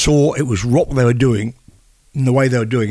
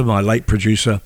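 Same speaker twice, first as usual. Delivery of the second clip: ordinary delivery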